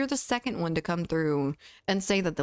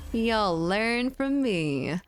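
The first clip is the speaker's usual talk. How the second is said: put-on Southern accent; 3 semitones higher, much slower than usual. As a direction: drag it out in a playful Southern accent. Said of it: "Her Southern accent".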